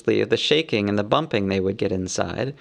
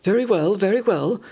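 higher, shrugging elf voice; lightly voiced elves yielding; elves answering; 8 semitones higher than usual. A narrator's reading. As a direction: give in with a sigh. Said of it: "they answered".

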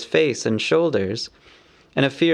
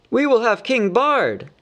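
much higher defiant cry; crowd shouting; 10 semitones higher than usual. A narrator's reading. People near at hand answering the master: high defiant shout. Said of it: "shouted in reply".

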